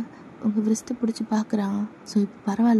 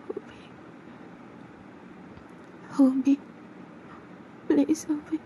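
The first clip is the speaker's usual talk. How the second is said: higher, frightened begging; calling Homi, afraid; frightened girl voice; scared plea; 6 semitones higher, 7 dB quieter than usual. Acted.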